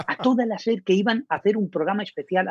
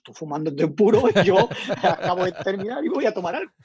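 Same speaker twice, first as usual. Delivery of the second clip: amused retort; 3 semitones higher, 3 dB louder than usual. Retold or imitated. Joking retold reply, laughing.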